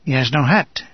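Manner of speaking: usual speaking voice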